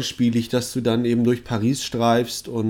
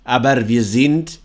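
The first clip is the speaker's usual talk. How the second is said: lower, slow Eastern European vampire voice; Hungarian vampire accent; 3 semitones lower, slower than usual. Acted. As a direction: play a broken-German vampire from Ungarn. Plays the half-vampire.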